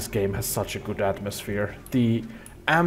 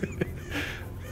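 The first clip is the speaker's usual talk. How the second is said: high giggle; 12 dB quieter than usual. Real, not acted. Laughs mid-sentence at own joke.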